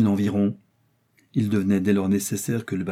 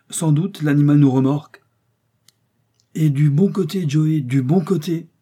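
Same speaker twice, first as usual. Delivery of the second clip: higher, louder, exultant call; excited exclamation; 6 semitones higher, 5 dB louder than usual. A narrator's reading. Travellers realise the elephant is towing them, excited exclamation. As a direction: call out the good news, raised voice. Excited exchange about being towed.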